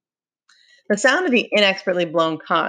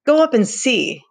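louder, higher scornful dare; contemptuous; 7 semitones higher, 5 dB louder than usual. A narrator's reading. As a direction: throw it out with contempt. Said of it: "said Jack contemptuously".

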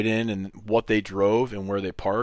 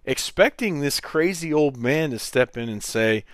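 ordinary talk, nothing marked

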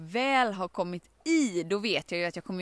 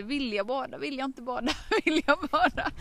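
giggling dither; 6 semitones higher than usual. Retold or imitated.

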